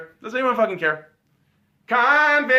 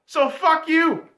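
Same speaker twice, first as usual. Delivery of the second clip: usual speaking voice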